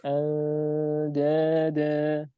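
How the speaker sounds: Andada very slow, with a light, thin nasal before the d; thin ikhfa. Shown as a demonstration. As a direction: stretch Andada slowly and keep it light. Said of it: "tapi dia tipis". (Andada dibaca tipis dan sangat pelan)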